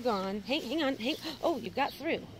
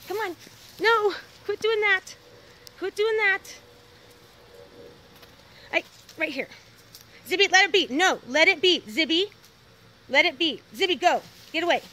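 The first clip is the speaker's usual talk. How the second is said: high, loud scolding; scolding the dog; 7 semitones higher, 10 dB louder than usual. Real, not acted. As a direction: tell the dog off firmly.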